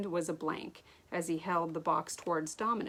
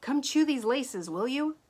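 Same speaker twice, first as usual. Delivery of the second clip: calling up; 7 semitones higher, 5 dB louder than usual. Acted. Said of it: "Ash called to the chipmunks".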